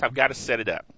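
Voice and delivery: ordinary talk, nothing marked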